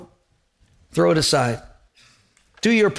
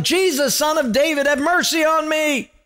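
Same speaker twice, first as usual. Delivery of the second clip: yelling for mercy; 11 semitones higher than usual. Retold or imitated. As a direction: cry out to Jesus, pleading. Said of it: "he starts yelling".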